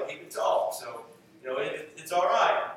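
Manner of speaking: ordinary talk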